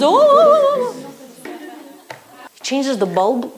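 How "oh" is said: very high, loud drawn-out oh; mother panicking at the chair; sung alarmed gasp. Retold or imitated. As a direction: wail in mock alarm. Mother gasps as he wobbles.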